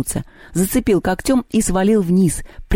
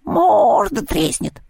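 squawking taunts; 10 semitones higher than usual. A narrator's reading. Mermaid quarrelling with the cat, squawking taunts. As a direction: stretch the insults into high squawks. Bird-woman jeering at the cat.

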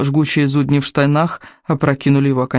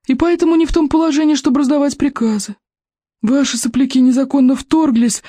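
put-on cranky old librarian; 10 semitones higher than usual. A narrator's reading. Голос библиотекаря. (сварливый старик-библиотекарь, высоко и протяжно)

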